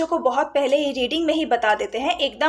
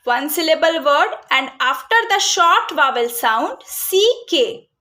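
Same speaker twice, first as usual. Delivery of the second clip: very slow, each word spelled out; slow dictated rule; much slower than usual. Shown as a demonstration. Teacher to parents, dictating the rule very slowly. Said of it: "तो बच्चों को हमको ये कैसे बताना है".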